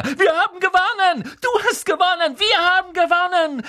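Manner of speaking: very high, loud cheering; excited shouting. A narrator's reading. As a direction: shout it out in triumph. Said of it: "rief Ron".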